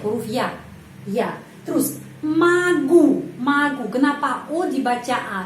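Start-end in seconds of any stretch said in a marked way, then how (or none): 1.6-3.9 magu said slowly with stress on the U, O sounding like A; teaching stress. Shown as a demonstration. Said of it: "penekanannya berada pada huruf U".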